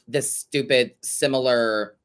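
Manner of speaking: normal talking voice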